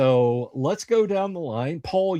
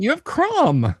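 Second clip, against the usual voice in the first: excited; 14 semitones higher, 7 dB louder than usual. Real, not acted. Excited reminder.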